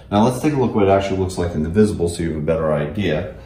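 normal talking voice

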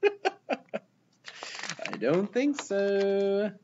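laughing slow aside. Real, not acted. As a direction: chuckle it out slowly. Narrator's aside to kids.

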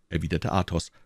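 ordinary delivery, nothing marked

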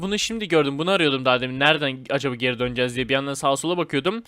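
ordinary talk

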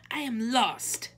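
high, loud, demanding princess; imperious princess, pitched high. A narrator's reading. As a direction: announce it like an entitled princess. Princess in the story.

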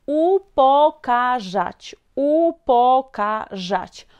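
slow "Upokarzać", broken into u-po-ka-rzać; slow syllable-by-syllable. Shown as a demonstration. Teacher shows where ż is heard, slow syllable-by-syllable. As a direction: draw out each syllable of "Upokarzać" up to the zh sound. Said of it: "¿Y aquí cómo pronuncio ż?".

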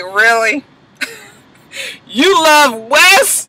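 high, slow taunting drawl; menacing Frank. Retold or imitated. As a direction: tease her, slow and sly. Frank surprising Laurel, mocking.

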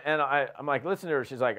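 ordinary delivery

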